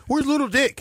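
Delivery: high, taunting question; mock call-out. Gang member mockingly calling out for Little Dick.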